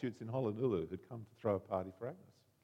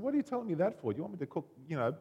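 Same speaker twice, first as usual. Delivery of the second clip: nasty retort; 5 semitones higher, 6 dB louder, faster than usual. Retold or imitated. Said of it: "her friend was quite nasty".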